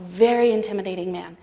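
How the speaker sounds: usual speaking voice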